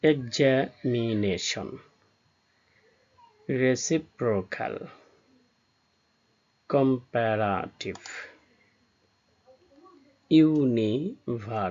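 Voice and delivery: "Examination, reciprocal, comparative, university" drawn out slowly in separate syllables. Shown as a demonstration. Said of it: "যে ওয়ার্ডে তিনটির বেশি syllable". ("Examination, reciprocal, comparative, university" ধীরে, syllable ভেঙে ভেঙে)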